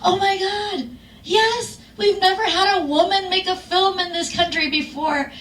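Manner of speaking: high-pitched, thrilled gushing; overjoyed producers. Retold or imitated. Film producers thrilled at a first woman director, gushing excitedly. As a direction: imitate delighted people celebrating. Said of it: "they were so happy".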